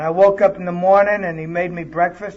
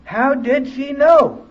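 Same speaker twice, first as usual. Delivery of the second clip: louder, higher, slow astonished repeat; amazed exclamation; 6 semitones higher, 4 dB louder, slower than usual. Retold or imitated. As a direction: let the wonder burst out. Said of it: "I thought".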